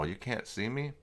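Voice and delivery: ordinary delivery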